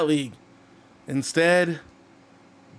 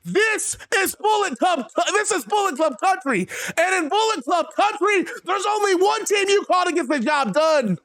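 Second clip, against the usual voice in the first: high, fired-up promo yell; promo shout; 11 semitones higher than usual. Retold or imitated. Wrestler hyping his team to the crowd, fired-up yell. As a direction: pump up the crowd, rising to a shout.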